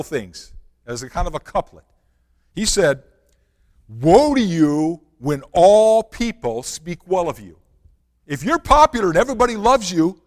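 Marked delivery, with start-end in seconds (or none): 3.9-7.6 slow, loud, solemn pronouncement; solemn quote. Acted. Preacher voicing Jesus's warning to the crowd, slow and grave. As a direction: intone it like a prophet's warning.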